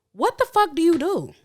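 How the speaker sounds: ordinary delivery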